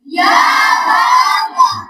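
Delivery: high, breathy mock "یا با با"; hammed-up child's error "با با"; kids' misreading. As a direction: mimic the children's wrong "با با". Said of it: "کیا پڑھتے ہیں بولیے".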